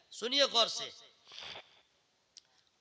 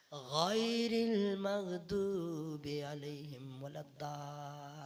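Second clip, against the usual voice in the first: how you recite; 3 semitones lower, 4 dB louder, much slower than usual. Retold or imitated. Preacher mimics the congregation's sloppy recitation. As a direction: lazily drawl it like an untrained reader. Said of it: "आगे दुआ कैसे बोलते हैं?".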